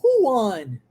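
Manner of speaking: "Who won?" falling steeply; emphatic downward glide on "Who won?"; marked intonation. Shown as a demonstration. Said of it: "Tá vendo a entonação?".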